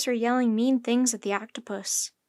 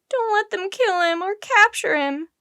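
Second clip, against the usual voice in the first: high, loud tearful begging; distraught high-pitched appeal; sobbing plea; 9 semitones higher, 6 dB louder than usual. A narrator's reading. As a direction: plead desperately through tears. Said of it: "she sobbed".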